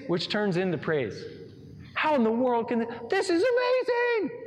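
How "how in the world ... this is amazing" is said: stretched, high-pitched cry of wonder; amazed crowd. Acted. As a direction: pitch jumps way up on amazing.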